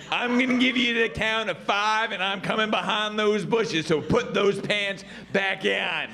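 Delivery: stern warning with a slowing finish; mildly hammed-up coach authority; lightly voiced gym coach. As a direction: lay down an ultimatum to students.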